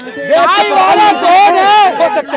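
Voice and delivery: loud, high, stretched-out yell; protest shout. Real, not acted. Shouting amid protest argument.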